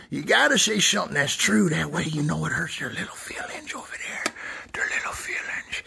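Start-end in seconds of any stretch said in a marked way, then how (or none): 1.7-5.8 hushed and gleeful, savoring it; mischievous whisper. Acted. Whispering to viewers how to hurt their little feelings. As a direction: whisper it like a nasty little secret.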